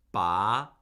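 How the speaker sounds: "ba" starting low and sliding upward; rising tone. Shown as a demonstration. Teacher shows four different Mandarin words, rising tone. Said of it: "those are four completely different words".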